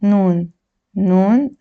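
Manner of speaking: ordinary talk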